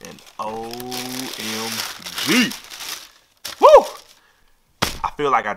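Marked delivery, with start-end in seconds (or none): 0.1-2.5 drawn-out, savored O M G; super excited. Real, not acted. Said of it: "Excuse me if I sound super excited". 3.6-3.9 short, high, loud woo; excited whoop. Real, not acted. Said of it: "Excuse me if I sound super excited".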